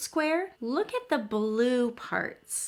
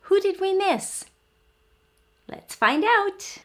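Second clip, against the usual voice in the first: curious teacher voice; 8 semitones higher than usual. Acted. Teasing question to children.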